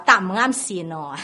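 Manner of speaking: 用广东话说; Cantonese phrase. Retold or imitated. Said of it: "我用广东话的话".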